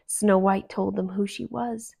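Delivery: ordinary delivery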